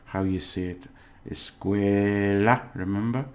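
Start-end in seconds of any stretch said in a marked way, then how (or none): 1.3-2.6 "Escuela" slowly, with "cue" drawn out; stressed syllable. Shown as a demonstration. Teacher reminds learners where stress falls, syllable drawn out. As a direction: stretch the middle syllable of "Escuela". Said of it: "the pronunciation is stress, where you do it".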